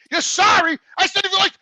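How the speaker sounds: yelling at the caller who dropped him; retold shouting. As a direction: shout her down in outrage.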